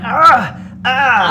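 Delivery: pained cries. Acted. GM voices whipped slave, sharp pained yelps. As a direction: cry out as the whip lands. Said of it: "you hear him say".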